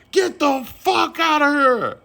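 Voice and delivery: high, slow shrieking yell; snapped scream. Retold or imitated. [name] screaming at Ryan like the movie character. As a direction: stretch out a high scream at the room. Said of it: "That was [name] with Ryan Pop".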